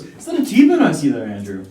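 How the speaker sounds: big excited gasp of delight; light up at the steak. Acted. Excited over the T-bone.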